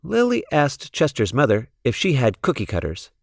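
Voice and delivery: usual speaking voice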